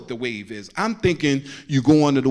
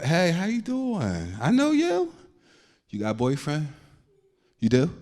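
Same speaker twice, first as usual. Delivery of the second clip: smooth flirt; 2 semitones higher than usual. Acted.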